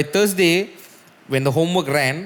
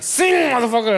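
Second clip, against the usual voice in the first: mock demand; 10 semitones higher, 3 dB louder than usual. Mock punchline of the urging.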